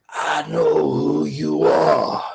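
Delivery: put-on very slow, drawn-out elderly voice; old man. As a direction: stretch each word like a very old man. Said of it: "I could see this old man".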